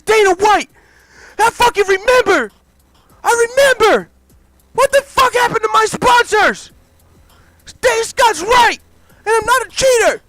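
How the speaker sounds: high, loud raging tirade with a sob; furious rant. Acted. Alistair screams at Dana about the steroids, furious high rant. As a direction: unload everything on him, screaming.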